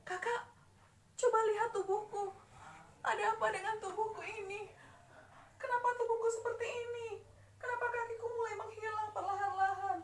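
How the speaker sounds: put-on high-pitched scared child asking; a child panicking at its own body; frightened little sibling. Transforming child asks older sibling what is happening, frightened.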